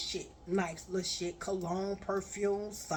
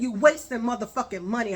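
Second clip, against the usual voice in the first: louder and faster, scolding; angry rant; 8 dB louder, faster than usual. Retold or imitated. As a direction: tear into him, raising your voice. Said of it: "I said".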